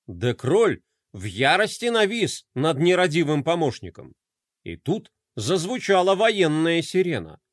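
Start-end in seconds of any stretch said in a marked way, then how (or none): none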